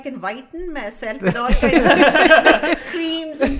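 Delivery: real laughing protest. Real, not acted. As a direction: joke through your own laughter. Joking about whitening herself.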